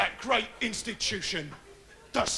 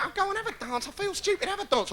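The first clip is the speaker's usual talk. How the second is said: put-on high soft girl voice; high soft girl wanting to dance; girly voice; 10 semitones higher, 4 dB quieter, faster than usual. Girl voiced in disco scene.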